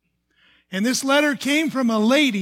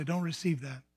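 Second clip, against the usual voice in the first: a soft, hushed inner voice; barely said, a quiet inner nudge; lower, softer inner voice; little voice; 4 semitones lower, 10 dB quieter than usual. Retold or imitated. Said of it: "I heard that little voice say".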